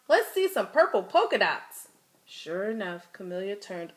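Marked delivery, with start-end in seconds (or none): 0.1-1.9 loud, higher taunting call from a classmate; kid yelling out. Acted. A schoolkid yelling a taunt at Camilla. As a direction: shout it across the classroom to tease. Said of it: "One yelled out".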